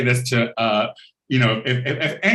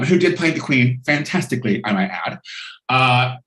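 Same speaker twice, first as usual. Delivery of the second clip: normal talking voice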